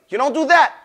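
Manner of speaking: ordinary talk, nothing marked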